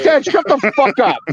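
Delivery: high-pitched comic outburst at Dad; retold exasperated snap. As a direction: cut Dad off, acting out the quarrel. Retold snapping at calming dad.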